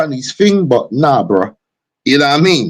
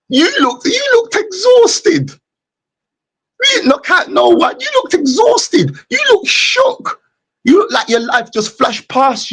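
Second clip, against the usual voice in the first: mocking taunt. Real, not acted. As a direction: taunt him in a high, squealing cry. Taunting opponent over the fight.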